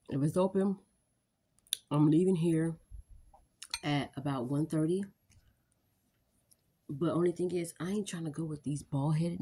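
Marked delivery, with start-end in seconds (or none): 0.1-5.0 taking a bite mid-sentence; speaking with food in mouth; talking while eating. Real, not acted. Seen 0: holds fork throughout; brings food to mouth at 3.9-4.2.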